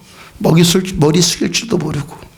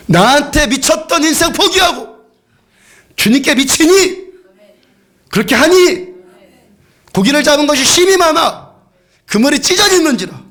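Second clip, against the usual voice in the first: fervent preaching; 10 semitones higher than usual. Acted. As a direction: preach it out with rising fire. Preaching at full force.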